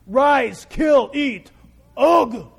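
loud, punched grunting commands; God's guy talk. Acted. God speaks to Peter man to man, blunt guy talk. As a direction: bark each word like a blunt guy. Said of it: "I love the way God speaks to Peter".

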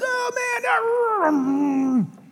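high-pitched wailing imitation of a heckler; mock drunken ranting. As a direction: wail it out high, trailing into noise. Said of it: "and this guy's".

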